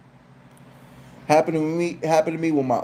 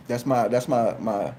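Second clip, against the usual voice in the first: normal talking voice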